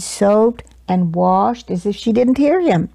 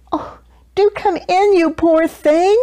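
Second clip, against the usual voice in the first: welcoming; 8 semitones higher than usual. A narrator's reading. Kindly welcoming a stray lion.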